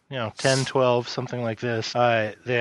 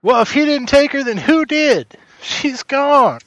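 high, loud anguished cry; a frantic parent calling out; high and loud distraught voice; distraught villager; 13 semitones higher, 6 dB louder than usual. Acted. GM voicing distraught NPC.

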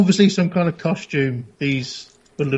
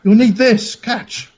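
calling out; 6 semitones higher, 5 dB louder, much slower than usual. Acted. Player calls out while throwing leg.

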